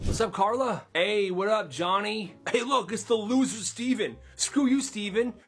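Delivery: high, cocky school bully voice. Retold or imitated. Popular bully greeting friends and mocking Steven, cocky and high. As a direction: swagger through the hallway taunts.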